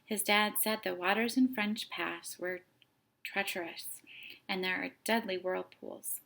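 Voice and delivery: plain reading voice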